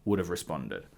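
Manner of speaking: ordinary talk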